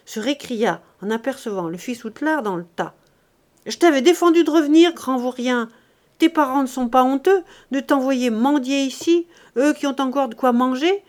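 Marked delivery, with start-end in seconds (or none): 3.7-11.0 loud, fast, stern rebuke; harsh scolding. Acted. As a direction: chase the boy away with a sharp telling-off. Scolding a begging boy.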